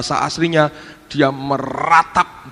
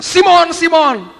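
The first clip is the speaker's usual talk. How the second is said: high, loud call by name; raised, urgent preacher's shout; acted shout; 13 semitones higher, 7 dB louder, slower than usual. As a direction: cry out the name to get his attention. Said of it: "Lalu dia teriak".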